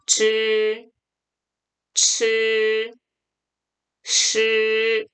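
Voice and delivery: 질, 칠, 실 held long and level, r-coloured; correct curled retroflexes. Shown as a demonstration. Teacher drills the series, retroflex 질, 칠, 실. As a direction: curl the tongue on steady 질, 칠, 실. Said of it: "이렇게 읽어주셔야 돼요".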